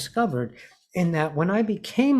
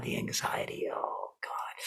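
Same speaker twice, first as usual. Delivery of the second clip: put-on softer, whispered dread of old anxiety; 12 dB quieter than usual. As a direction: breathe it out like a bad memory.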